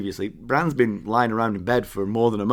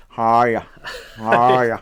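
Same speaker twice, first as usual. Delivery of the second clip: attempted American accent; 6 dB louder than usual. Shown as a demonstration. Co-host mocking his own unclear greeting, attempted American accent. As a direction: overdo an American accent, pirate-like. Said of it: "You tried to do an American accent".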